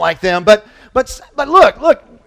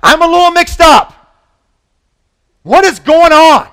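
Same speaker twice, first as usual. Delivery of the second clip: mock confused Jesus; 7 semitones higher, 8 dB louder than usual. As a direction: ham up a puzzled voice for effect. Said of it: "Jesus is saying, like".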